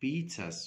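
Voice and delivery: pizzas said as "pitsas", the zz sounding ts and the s plain; pizzas: say "pit-sas"; pronunciation demo. Shown as a demonstration. Teacher shows pupils how pizzas is really said. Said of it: "أقول".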